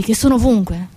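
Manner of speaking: normal talking voice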